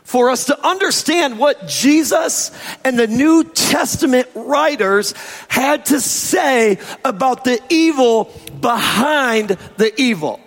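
sermon delivery to the congregation; preaching. Acted. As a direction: preach it from the pulpit.

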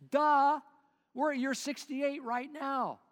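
high, loud sudden discovery; excited realization. Acted. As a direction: burst out as it dawns on you.

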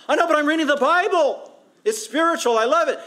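high, loud mock protest of his younger self; hammed-up college kid defending himself; giddy young convert. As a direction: insist you would rather read. His younger self answers back.

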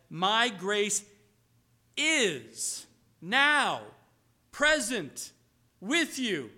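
loud, slow, emphatic declaration; declaring God's word. Acted. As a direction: proclaim it with weight.

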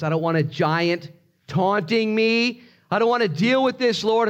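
high, insistent protest to God; acted desperate complaint; escalating plea. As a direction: plead harder, voice climbing high.

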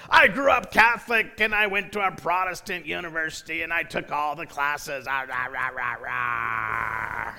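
hammed-up boast trailing into low drone; loudmouth passenger. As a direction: imitate a blowhard rattling on. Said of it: "in that same voice".

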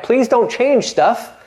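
high, slow pleading whine; imitating nervous churchgoers begging; mock scared plea. Pastor mimics scared congregants pleading, high and slow. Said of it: "they get scared".

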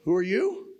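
high, startled whoa; startled question. Retold or imitated. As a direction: Moses balking at God, high and startled. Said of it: "And then he says".

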